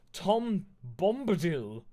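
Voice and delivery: loud, drawn-out grand announcement; big slow reveal; dramatic reveal. A narrator's reading. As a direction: proclaim the name slowly and high.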